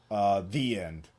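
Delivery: ordinary delivery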